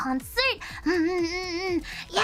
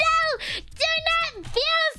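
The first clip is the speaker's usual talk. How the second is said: high eager reassurance; 8 semitones higher than usual. Retold or imitated. Reassuring nervous fan, high.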